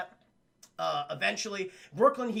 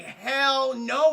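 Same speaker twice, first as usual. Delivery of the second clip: loud, high mock indignation; emphatic refusal; 5 semitones higher, 8 dB louder than usual. Elisa refusing to accept Goliath's state, emphatic. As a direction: belt out the refusal.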